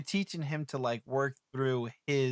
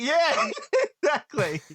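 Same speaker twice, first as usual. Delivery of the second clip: laughing reply; 12 semitones higher, 7 dB louder, slower than usual. Retold or imitated. Mock answer to her son.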